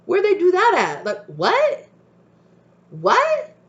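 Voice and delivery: can't believe they ignored it; exasperated spirit guides. Retold or imitated. Spirit guides baffled that the warning was swept up, shrill disbelief. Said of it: "your spirit guides are like".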